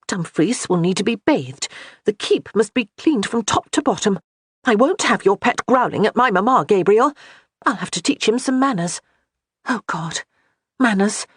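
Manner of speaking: rattled, listing her worries; flustered wife. A narrator's reading. Johanna fretting over chores.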